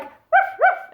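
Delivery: high yappy little dog bark; small dog bark. Shown as a demonstration. Imitating little dogs for a barking lesson, high yap. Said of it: "little dogs who are like".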